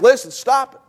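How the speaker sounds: put-on angry father telling the kids to stop; mad scolding. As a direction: cut the kids off, annoyed. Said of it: "I'm just mad".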